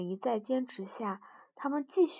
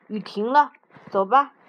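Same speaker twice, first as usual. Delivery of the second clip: much louder brisk call; 14 dB louder than usual. A narrator's reading. Mouse calls frog to leave after rain, loud. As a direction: call it out firmly to move on.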